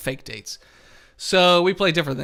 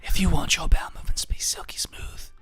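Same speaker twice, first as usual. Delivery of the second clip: breathy mock ASMR whisper; 4 dB quieter than usual. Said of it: "A S M R".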